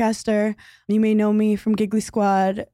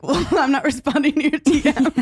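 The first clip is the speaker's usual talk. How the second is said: higher, laughing through the retold line; laughing comeback; 5 semitones higher than usual. Retelling a reply to a comic who DM'd, laughing. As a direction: let a laugh break into the retort.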